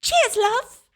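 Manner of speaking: high, chirpy British catchphrase; Tracer. Acted. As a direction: mimic a perky British game heroine. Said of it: "reminds me of Tracer from Overwatch".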